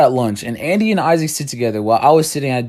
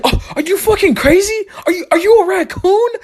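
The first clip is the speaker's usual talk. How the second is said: very high, half-laughing squeal of disbelief; a high, laughing, near-crying mock shriek; laughing outrage.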